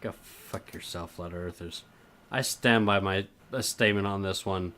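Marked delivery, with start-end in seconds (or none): 0.0-1.8 very quiet, low aside; muttered insult. Real, not acted. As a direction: slip the curse in under your breath. Muttered insult to flat earthers.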